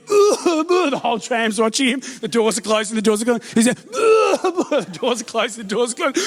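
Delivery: rising suspense, laughing through it; breathless comic build-up; mounting excitement. Retold or imitated. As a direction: build the tension, giggling. Suspense build in tram story.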